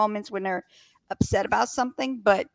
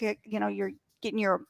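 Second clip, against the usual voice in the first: usual speaking voice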